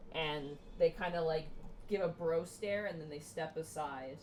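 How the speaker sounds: ordinary talk, nothing marked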